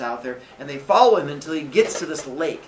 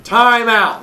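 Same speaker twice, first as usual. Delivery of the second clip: calling time out; 6 semitones higher, 10 dB louder than usual. Retold or imitated. Aesop calling for calm.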